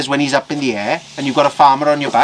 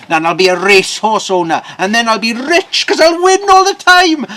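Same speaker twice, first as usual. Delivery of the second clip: loud, rising to a high squeal; excited daydream; 8 semitones higher, 9 dB louder than usual. Acted. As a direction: get carried away dreaming of riches. Farmer dreams of riches.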